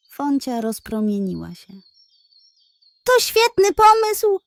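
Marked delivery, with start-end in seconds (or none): 3.0-4.4 high, loud, thrilled cry; excited exclamation. Acted. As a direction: shout out the idea with joy. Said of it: "zawołała".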